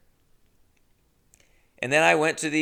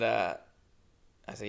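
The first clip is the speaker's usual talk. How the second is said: ordinary talk, nothing marked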